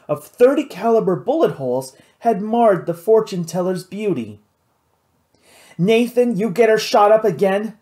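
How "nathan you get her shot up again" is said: higher-pitched aggrieved gripe; griping. A narrator's reading. Jack warning Nathan over his marred artwork, griping. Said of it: "Jack had griped".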